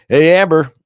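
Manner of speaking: loud, high hail; calling out greeting. Real, not acted. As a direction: greet a friend loudly as they arrive. Host hails an arriving viewer.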